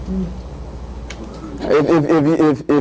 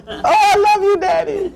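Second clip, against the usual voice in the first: lightly voiced young girl, a bit higher, laughing; little daughter; 13 semitones higher than usual. Father retelling his daughter's loving words as she hugs him. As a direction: play the hugging daughter's gush. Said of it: "she gave me this big hug".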